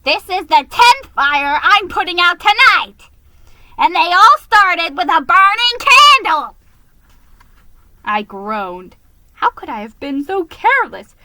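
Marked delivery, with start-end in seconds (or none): 0.0-6.6 gruff fire mouse. A narrator's reading.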